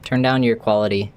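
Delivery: ordinary talk, nothing marked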